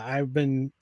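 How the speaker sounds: normal talking voice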